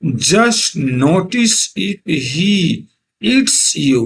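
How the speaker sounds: very slow, each word drawn out; slow poem reading. Shown as a demonstration. Teacher reading a poem line to students, very slow. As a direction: read it word by word for the class. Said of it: "teacher reads poem line".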